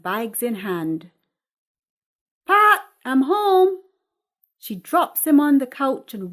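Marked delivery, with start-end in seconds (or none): 2.5-3.8 high, loud call through the house. A narrator's reading. Mother arriving home calls to daughter, loud and high. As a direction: call from the front door.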